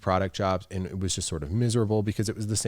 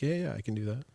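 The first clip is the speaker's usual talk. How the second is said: a slightly higher, fake-agreeable yeah; mock-eager can-do reply; forced cheerful yes; 4 semitones higher, 3 dB quieter, faster than usual. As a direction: smile through it and agree. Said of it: "smile and be like".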